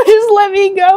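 high, loud laughing wail; desperate laughing scream; screamed plea. Real, not acted. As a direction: beg at full voice, half crying. Begging the game, screaming.

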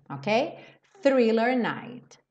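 normal talking voice

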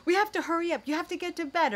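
high, loud hurrying yell; yelling at son. Retold or imitated. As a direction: bark the bedtime order. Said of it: "yelling at, to my son".

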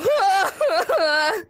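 high crying laugh; breathless shrieking laughter; laughing hard. Real, not acted. Laughing after escape.

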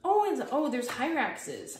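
high, loud and drawn out; excited. Real, not acted. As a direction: burst out with delight. Excited discovery of hyrax drawings.

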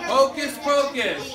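lively chanted call to the class; sing-song classroom callback; attention call. Acted.

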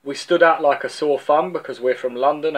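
ordinary talk, nothing marked

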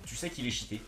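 ordinary delivery

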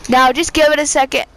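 normal talking voice

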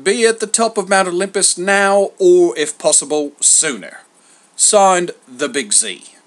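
loud herald's reading, easing down at the end; lusty proclamation. A narrator's reading. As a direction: read out the summons at full voice. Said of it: "in a lusty voice began".

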